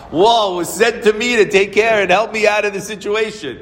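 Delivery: high, loud amazed exclamation; retold outburst. Caught man excusing himself to the rabbi, high excited outburst. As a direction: act out an overawed, emphatic excuse.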